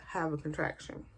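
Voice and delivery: ordinary talk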